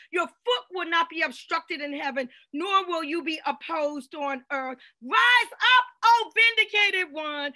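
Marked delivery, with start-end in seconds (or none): 5.0-7.5 high, loud, slow shouted proclamation; preacher shouting scripture to the congregation; fiery preaching. Real, not acted. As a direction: command them to rise, full force.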